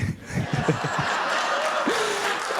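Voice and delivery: a real burst of high laughter; rising, airy burst of laughter. Real, not acted. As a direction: laugh at your own joke. Laugh after own joke.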